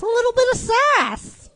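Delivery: very high, playful squeal; high-pitched sassy outburst. Acted. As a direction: shoot the pitch way up for sass. Repeats the sassy comment.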